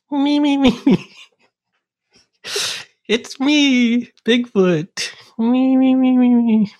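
very high squeaky Bigfoot; yappy chihuahua voice. Pretend. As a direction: squeak for attention like a tiny creature. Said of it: "what your Bigfoot sounds like".

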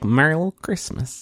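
teasing a cohost with a hint of Midwestern twang. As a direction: echo the phrase in a mild Midwestern twang. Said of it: "It's my Midwestern twang".

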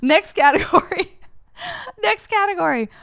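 high, loud, laughing through the words. Real, not acted. Host laughing at her own sudden move on. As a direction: giggle out the host line.

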